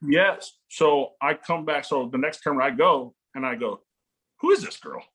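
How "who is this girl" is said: much higher, astonished question; amazed. Retold or imitated. Dad to himself watching his daughter play, high amazement. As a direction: marvel at the girl smashing it.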